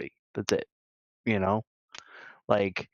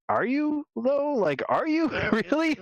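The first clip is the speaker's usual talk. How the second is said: incredulous challenge; 14 semitones higher than usual. Acted. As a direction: stretch it out and pitch it way up in disbelief. Acted sceptical retort to self-righteous people.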